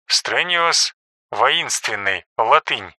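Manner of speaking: soft footnote reading; quiet aside. A narrator's reading. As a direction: drop the voice for a footnote.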